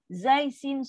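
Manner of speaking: normal talking voice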